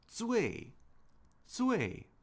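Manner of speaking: 最 pitched very high with a sharp fall; modelled tone. Shown as a demonstration. Teacher teaches the word for most, high falling tone. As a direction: say 最 separately, high and falling, twice.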